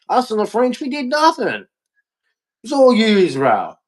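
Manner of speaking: sarcastic high whine of false innocence; sarcastic mock voice. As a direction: act hurt and sarcastic, voice climbing.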